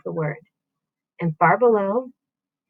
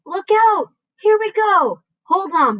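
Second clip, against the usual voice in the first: high, loud cries; excited shout; 10 semitones higher, 4 dB louder, slower than usual. A narrator's reading. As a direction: brace everyone as it lifts. Peach lifting, crying out.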